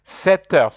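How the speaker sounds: a teacher stressing "Sept heures"; French "sept heures". Shown as a demonstration. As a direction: lift "Sept heures" up, linking the t into heures. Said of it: "I say".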